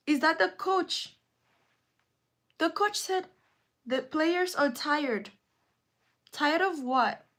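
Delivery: high, loud, indignant ranting; worked-up rant. Real, not acted. Vlogger ranting to her live viewers, high and loud. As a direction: vent the outrage at full pitch.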